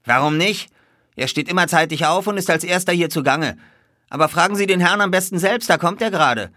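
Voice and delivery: high, loud stablehand voice; obliging stablehand. A narrator's reading. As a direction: answer readily as a helpful stable lad.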